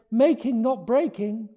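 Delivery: high, bright little plea; eager little dragon. Acted. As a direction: promise it eagerly like a small child. Small dragon's promise.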